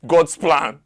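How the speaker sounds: "God's plan" yelled high in scornful rage; furious mocking shout. Mocking the phrase back in rage.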